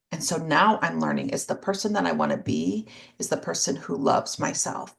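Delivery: ordinary delivery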